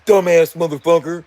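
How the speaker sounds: mocking trolls. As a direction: sneer like a troll laughing at the singer. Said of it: "they can talk to each other and say".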